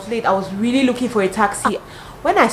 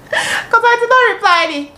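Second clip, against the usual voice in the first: high, laughing through words; 6 dB louder than usual. Real, not acted. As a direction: laugh as you say it. Laughing about not replying.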